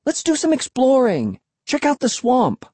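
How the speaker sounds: put-on high, excited kid voice; keen, high-pitched coaxing; eager urging. A narrator's reading. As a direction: talk your sister into an adventure. Eager kid urging sister along.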